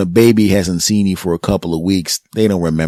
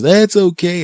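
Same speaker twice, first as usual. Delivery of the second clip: high sweet cooing voice; cooing at the baby; 12 semitones higher, 5 dB louder, slower than usual. Retold or imitated. Relatives cooing at the crying baby, high and sweet. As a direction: coo it up high like to an infant. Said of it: "And everybody says".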